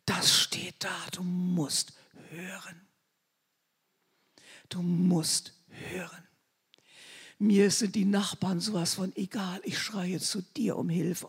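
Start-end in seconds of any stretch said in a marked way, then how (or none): 0.0-6.3 low, soft, slow plea to the Lord; hushed earnest prayer voice; pleading prayer. Shown as a demonstration. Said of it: "dann sage ich, Herr". 9.6-11.3 soft unvoiced cry for help; hushed prayer to Jesus; whispered prayer.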